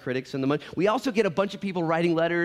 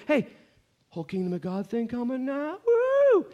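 much higher, whooping excitement; mock eager cheer; 10 semitones higher, 3 dB louder than usual. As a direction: hype it up like a fan at a game. Voicing eager disciples.

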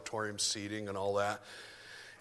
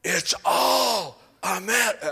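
slow, loud, much higher, strained cry; imitating a preacher pounding the podium; vociferous preacher; 8 semitones higher, 10 dB louder, much slower than usual. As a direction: slam it down like a fist on the podium. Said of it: "slammed his hand down on the podium".

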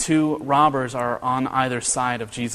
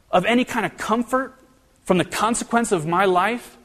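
higher, earnest plea of the dying thief; pleading criminal; 7 semitones higher than usual. Acted. Pastor voicing the thief on the cross, pleading higher. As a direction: lift the voice in a heartfelt plea.

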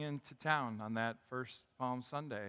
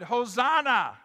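raised, loud preacher's cry of acclaim; retold crowd shout; 7 semitones higher, 10 dB louder, slower than usual. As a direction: call it out like the crowd. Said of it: "They shouted".

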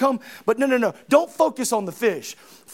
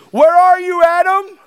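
higher, louder call; 5 semitones higher, 8 dB louder than usual. Retold or imitated. God calling out loud to Adam in the garden. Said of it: "read the questions of Jesus".

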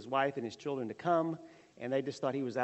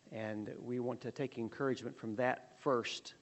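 prayer; 3 semitones lower than usual. Acted. Opening prayer before the lesson.

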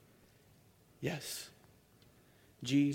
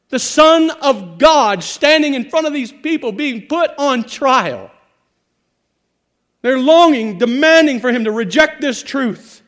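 loud, rising, impassioned preaching; full-force preaching; 11 semitones higher, 17 dB louder than usual. Acted. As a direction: preach it hard to the crowd.

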